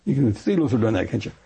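normal talking voice

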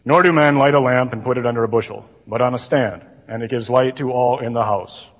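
low droning recital, no life in it; monotone gospel reading. Shown as a demonstration. Imitating a priest reading at Mass, monotone. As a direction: drone through the verse without expression. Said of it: "Ever heard it read like that?".

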